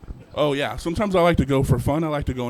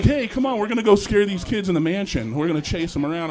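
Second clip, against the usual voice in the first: pushy ghost buddies; 6 semitones higher than usual. Retold or imitated. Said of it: "the other ghosts are like".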